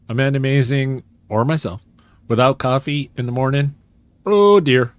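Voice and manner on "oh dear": alarmed oh dear. Real, not acted. Dread of no coffee.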